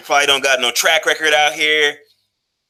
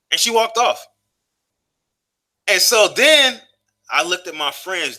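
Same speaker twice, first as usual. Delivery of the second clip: ordinary talk, nothing marked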